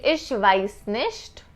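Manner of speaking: "Ich weiß nicht" said slowly and clearly; slow demo. Shown as a demonstration. Teacher slowing the German for students. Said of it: "you can say".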